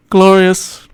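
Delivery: high "glorious" at normal volume; high-pitched relish. Acted. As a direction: lift "glorious" up high and hold it. Same word said low, then high.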